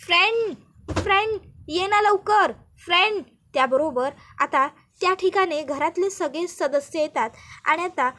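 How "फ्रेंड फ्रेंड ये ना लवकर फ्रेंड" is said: calling out. Acted. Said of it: "पूजा ही ओरडत असते".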